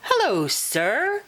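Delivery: high, tentative greeting; touch of nervousness in a fairy voice; a little afraid. Acted. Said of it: "a little afraid".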